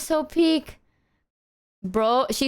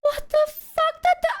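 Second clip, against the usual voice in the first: shocked disbelief. Real, not acted. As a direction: gasp it out in disbelief. Shocked at a big donation.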